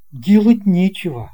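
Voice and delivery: plain reading voice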